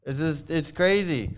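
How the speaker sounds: ordinary delivery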